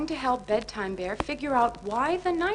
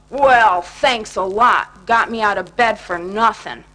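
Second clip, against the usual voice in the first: grumpy; 11 dB louder than usual. Acted. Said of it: "Grumpy Bear said".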